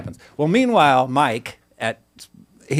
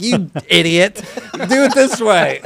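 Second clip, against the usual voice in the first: angry clerk, higher and louder, slow; mock angry clerk; 7 semitones higher, 5 dB louder, slower than usual. Sandwich clerk scolding the customer, mock annoyed. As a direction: snap at the customer, slowed and raised. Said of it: "he was all pissed off".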